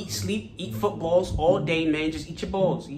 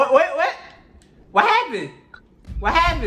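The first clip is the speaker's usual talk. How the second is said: startled; 12 semitones higher, 6 dB louder than usual. Pretend. Threatened by the fan.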